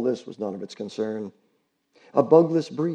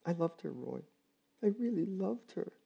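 much softer, tearful and pitched up; grieving; 6 semitones higher, 11 dB quieter than usual. Acted. Allison telling Roy about her dead pig, tearful and soft. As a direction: confess the love through tears.

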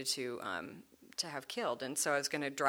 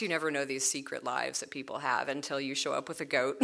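normal talking voice

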